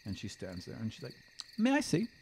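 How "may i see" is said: put-on lightly higher young elf asking; a polite, brighter elf query; elf's voice. The elf asking the player to see, lightly higher.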